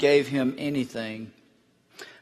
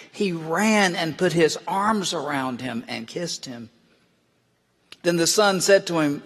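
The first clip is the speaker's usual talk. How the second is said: ordinary talk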